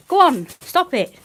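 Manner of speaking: sharp, loud, high command to the dog; real shout at a pushy dog; yelling at the dog. Real, not acted. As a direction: stop the dog in its tracks. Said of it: "I'm yelling at the dog".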